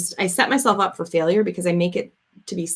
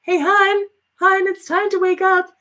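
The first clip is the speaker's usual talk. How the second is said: much higher, sing-song gentle wake-up call; Barry waking her; 11 semitones higher, 3 dB louder than usual. Retold or imitated. Barry gently calling her awake from the doorway, higher. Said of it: "he's like".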